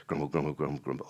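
low, soft grumble under the breath; grumbling. Acted. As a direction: grumble away to yourself, low. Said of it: "But the grumbling continues".

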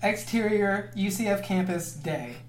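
ordinary talk, nothing marked

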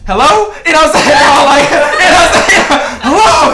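loud, high, rising hello; frantic phone greeting. Retold or imitated. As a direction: shout hello down the phone, flustered. Answering phone in a frenzy.

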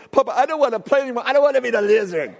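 put-on a boy begging to quit the game; whining little boy. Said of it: "He goes".

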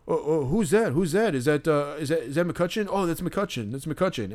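a hint of a dim fan squinting and guessing; clueless fans. Fans guessing the trainer's identity.

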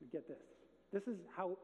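ordinary talk, nothing marked

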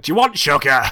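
exasperated shout at a customer; angry repeat. Retold or imitated. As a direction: snap it as if forced to repeat yourself. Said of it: "the second time's always very angry".

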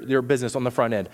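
normal talking voice

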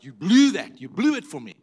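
loud, punched accusation; retold complaint, hitting "blew" hard; mock reproach. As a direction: blame God in a hammed-up outburst. Retold man blaming God afterwards.

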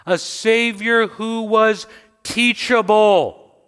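loud, high, slow proclamation; full-force preaching. Real, not acted. Preacher proclaiming the humbled Savior to the church, full-force preaching. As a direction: drive each phrase out, stretching the key words.

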